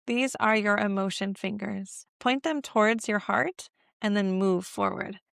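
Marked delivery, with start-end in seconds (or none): none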